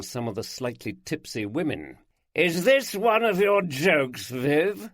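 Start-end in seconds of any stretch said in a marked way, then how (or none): 2.4-4.9 higher, louder, lazy teasing voice; a lounging guest mocking his host; louche guest.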